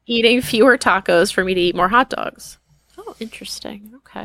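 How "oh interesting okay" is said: whispered aside. Real, not acted. Aside after cohost's reaction.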